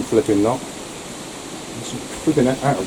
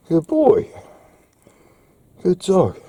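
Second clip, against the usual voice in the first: higher, fond praise for a good dog; praising the dog; 8 semitones higher, slower than usual. Real, not acted. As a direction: reward the dog with your voice. Praising his dog after vet.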